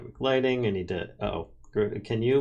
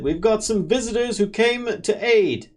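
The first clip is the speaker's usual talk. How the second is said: npc voice; 11 semitones higher, 4 dB louder than usual. Acted.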